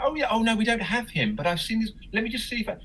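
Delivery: high-pitched voice fobbing off a caller; put-on receptionist voice; gallery staffer. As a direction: act the gallery worker, pitched well up.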